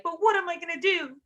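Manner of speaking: high mock helpless plea; hammed-up sad girl whine. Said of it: "sad girl about it".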